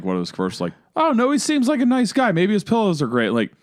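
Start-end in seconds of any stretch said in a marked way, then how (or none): 1.0-3.3 higher voice easing back down, lightly put on; lightly imitated wife. Husband retelling his wife's first impression, lightly imitated.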